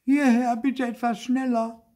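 high, loud cry for help; calling out. A narrator's reading. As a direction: call urgently to someone in the distance. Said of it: "Und Zäpfel rief". (laut und hoch rufend)

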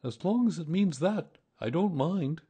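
humble little bear, high-pitched. A narrator's reading. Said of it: "said Pooh humbly".

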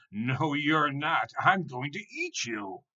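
loud, high, drawn-out threat; slow, menacing troll shout; troll's roar. A narrator's reading. Troll threatens to eat tiny goat, slow roar. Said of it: "roared the troll again".

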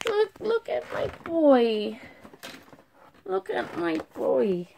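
tender gushing. Real, not acted. Gushing proudly over Steven.

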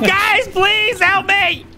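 high, drawn-out wail; desperate cry for help. Real, not acted. Carried victim begs for rescue.

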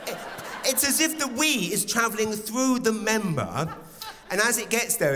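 ordinary talk

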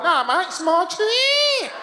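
very high, drawn-out Australian whine; Australian accent. Retold or imitated. Comedian mimics Australian guarding his chair, hammed-up high Australian accent. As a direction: stretch out a hammed-up Australian complaint. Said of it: "that's how they sound".

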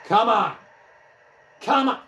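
high, loud protests; exasperated. Real, not acted. Player protests a run of bad luck, exasperated. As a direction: burst out in disbelief.

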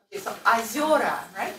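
ordinary delivery

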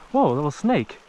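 usual speaking voice

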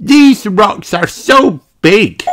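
put-on high, slow amazed child voice; gasping in awe. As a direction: marvel like a little girl, loud and drawn out. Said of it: "gasped Peppa".

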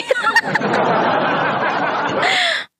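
embarrassed high giggle; high soft laugh. Real, not acted. Laughing at own English.

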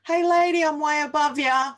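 high, loud, snooty put-on voice; mock a superior mum calling across the playground; hammed-up posh mum looking down on another mum; snooty judging mum.

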